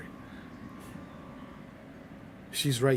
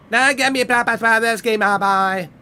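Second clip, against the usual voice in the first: loud, higher bossy tough-guy voice; mock tough guy; 8 semitones higher, 7 dB louder than usual. Streamer hamming a bossy hard man, loud and high. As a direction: bark the order like a swaggering hard man.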